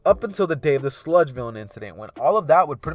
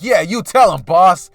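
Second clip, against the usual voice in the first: loud, higher-pitched egging on; rowdy goon hype; cheering henchman; 5 semitones higher, 10 dB louder than usual. Acted. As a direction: back up the boss with gusto. Goons cheer on their boss.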